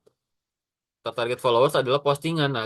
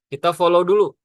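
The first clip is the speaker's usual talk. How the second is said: emphatic louder; 5 semitones higher, 8 dB louder than usual. Real, not acted. Key advice, emphatic.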